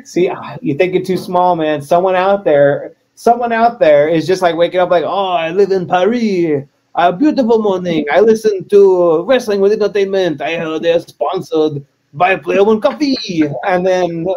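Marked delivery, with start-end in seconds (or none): 5.0-13.4 hammed-up French accent; terrible French accent. Parisian waking up to listen to wrestling, terrible French accent. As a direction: play a Parisian with a badly faked French accent. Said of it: "they're gonna hear my terrible French accent".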